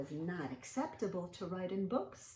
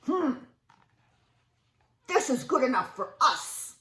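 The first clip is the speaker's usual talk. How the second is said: loud, gruff caveman voice; cavemen; 6 semitones higher, 12 dB louder than usual. Acted. Cavemen brushing off Stanley, loud and gruff. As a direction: boom it like a stubborn caveman. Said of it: "said the other cavemen".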